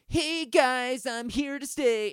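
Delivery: high, drawn-out put-on voice; cutesy mock singer voice. Mocking an unfitting vocal style to a friend, exaggerated high voice. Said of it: "a voice that's like".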